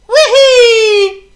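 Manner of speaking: very high loud joyful shout; triumphant whee. A narrator's reading. Potto flying the airplane, triumphant shout. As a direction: soar with glee. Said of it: "shouted Potto triumphantly".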